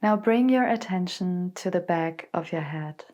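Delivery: calm, unhurried meditation voice with long pauses; guided meditation. Acted. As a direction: guide them gently into stillness, letting phrases settle. Leads a guided body scan meditation.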